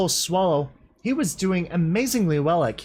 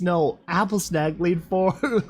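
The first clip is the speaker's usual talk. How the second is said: ordinary delivery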